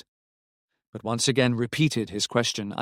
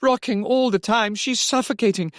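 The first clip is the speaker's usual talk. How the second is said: high, raised report down the phone; much higher, agitated voice; urgent call; 11 semitones higher, 3 dB louder than usual. A narrator's reading. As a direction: call it out in alarm. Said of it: "called Robbie".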